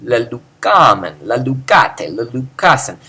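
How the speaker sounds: "la lucamen", "la lucate", "la lucasen" punched on KA; stress on alpha. Shown as a demonstration. Teacher reciting Greek plural perfect forms, stressed KA. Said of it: "or the alpha, the K is included".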